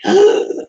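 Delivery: sudden high gasp of surprise; startled intake of breath; sharp gasp. Real, not acted. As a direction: catch your breath, caught off guard.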